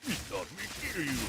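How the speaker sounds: high put-on brute voice, baffled; dumb ogre voice. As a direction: play the ogre mangling his words. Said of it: "he's like".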